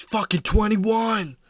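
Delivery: high, drawn-out mock shout; hammed-up bellow of a brag; mock boastful yell. Friend mocks a man bragging about his age, exaggerated yell.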